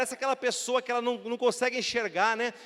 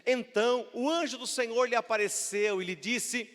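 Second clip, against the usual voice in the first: ordinary talk, nothing marked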